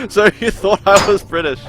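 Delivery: laughing. Real, not acted. Amused at being taken as British.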